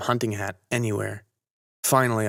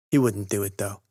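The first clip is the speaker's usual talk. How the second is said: usual speaking voice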